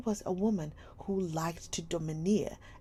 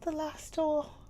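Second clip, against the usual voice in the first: put-on higher, louder girl's voice; mournful; 11 semitones higher, 5 dB louder than usual. A narrator's reading. Sarah repeating it to Miss Minchin, mournful and high. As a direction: let the sorrow break through. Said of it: "her little mournful voice had an odd sound".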